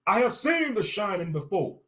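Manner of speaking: furious shout. Acted. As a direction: yell it at him. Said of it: "I shouted".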